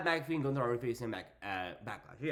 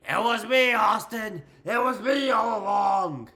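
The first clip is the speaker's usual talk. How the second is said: loud, higher, hammed-up villain reveal; mock dramatic confession; 4 semitones higher, 7 dB louder than usual. Bayley confessing to the crowd, hammed-up shout.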